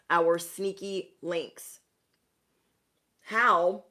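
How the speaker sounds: talking through a popcorn mouthful; words round a bite of popcorn; mouth full of popcorn. Real, not acted. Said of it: "sorry, I was eating popcorn earlier".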